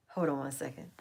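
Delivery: whispered aside. Real, not acted. Asking viewers to wait while tending the dog, whispered. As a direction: breathe it under your breath.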